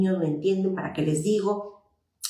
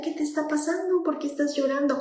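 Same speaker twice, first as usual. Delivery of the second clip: put-on gently asking a crying child; 8 semitones higher than usual. Shown as a demonstration. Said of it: "pregúntale". (madre o padre preguntando con ternura, en tono alto)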